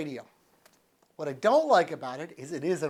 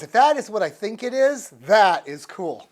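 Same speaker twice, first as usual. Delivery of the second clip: excited; 3 semitones higher, 8 dB louder than usual. Real, not acted. Discovering a surprise feature.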